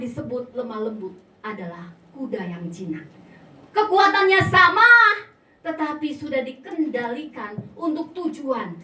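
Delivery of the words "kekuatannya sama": loud, high, forceful burst; emphatic proclamation. Real, not acted. Preacher compares wild and tamed horses, thundering. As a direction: thunder the key point.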